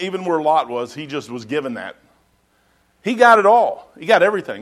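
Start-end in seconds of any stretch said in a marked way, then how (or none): 3.0-4.6 emphatic preaching. Real, not acted. Preaching climax on Abraham.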